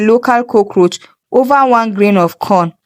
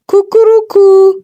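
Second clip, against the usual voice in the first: much higher, loud short cock crow; rooster crow; 10 semitones higher, 5 dB louder than usual. A narrator's reading. Chicken trying to demand rent, just crows. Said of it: "But it only said".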